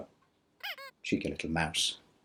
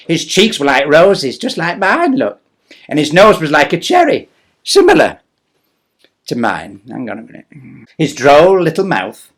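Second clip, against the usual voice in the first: louder, lively reading; storybook narration; 4 semitones higher, 13 dB louder than usual. Acted. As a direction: paint Santa for the children. Poem narration.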